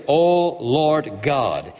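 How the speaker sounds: slow, solemn O Lord God; each word of "O Lord God" drawn out slowly; drawn-out invocation. Acted. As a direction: stretch out "O Lord God" reverently. Prayer opening voiced slowly.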